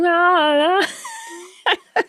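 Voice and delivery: high squealing cat cry, laughing through it. Retold or imitated. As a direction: imitate the cat wailing, half laughing. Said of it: "He's like".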